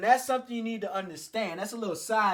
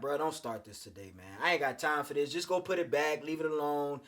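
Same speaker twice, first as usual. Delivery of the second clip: tired; 6 semitones lower, 6 dB quieter than usual. Retold or imitated. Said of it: "I was kinda tired".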